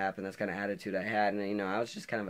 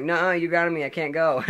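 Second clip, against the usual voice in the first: retold argument, higher and louder; defiant son; 8 semitones higher, 9 dB louder than usual. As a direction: throw mom's rule back at her. Arguing back in acted quarrel.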